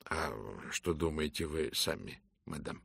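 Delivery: lightly voiced gallant detective; soft courteous Poirot. Poirot replies. (тихий учтивый голос)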